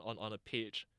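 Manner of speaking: ordinary talk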